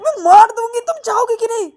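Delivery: high loud threat; threatening slap. Acted. Payal threatens to slap Ananya, loud and high. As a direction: raise the hand and threaten.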